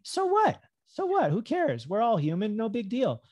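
high-pitched, carefree "so what"; lilting, unbothered brush-off; breezy dismissal. Acted. Voices shrugging off a slip.

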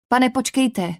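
blurted out. A narrator's reading. Girl stops a departing officer, blurting it out. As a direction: blurt it to stop him leaving. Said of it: "vyhrkla jsem".

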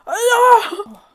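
high, loud cry of alarm; startled scream. Real, not acted. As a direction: yelp out in sudden shock. Startled at being low again.

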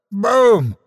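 high comic blast noise; explosion noise. Acted. Sound effect of the battle, comic blast. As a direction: splat it out like a cartoon explosion.

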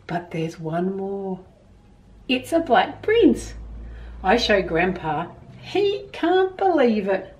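put-on gentle storybook reading to children; reading a story aloud. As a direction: read it like a picture book to kids. Reads picture book to children.

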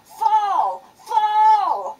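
frantic high yell of a panicking dad; screaming dad. Retold or imitated. Dad screaming at his son flying down the mountain, frantic high yell. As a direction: yell the warning at full volume. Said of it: "my dad starts freaking out and screaming at him".

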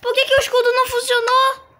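very high, fast panicked squeal; panicked shriek. Real, not acted. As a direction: shriek in disbelief. Shield failing mid-fight. (grito de pânico agudo)

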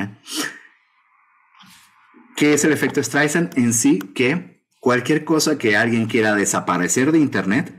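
ordinary talk, nothing marked

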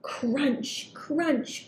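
ordinary delivery, nothing marked

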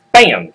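sudden loud bam; slapping impact. Acted. Goat knocks bear off mountain, explosive bam. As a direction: punch out a loud comic hit noise.

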